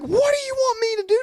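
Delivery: frantic high-pitched protest; frantic kid. Retold or imitated. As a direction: squeal it in a panic. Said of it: "And I'm like".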